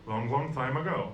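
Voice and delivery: ordinary talk, nothing marked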